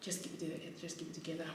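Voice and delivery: softly talking herself down, fading to a whisper; quiet self-soothing. Retold or imitated. Calming herself on the train after the fight, quiet, fading to a whisper. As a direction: hush yourself, almost breathless. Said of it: "I'm trying to, like, calm myself down".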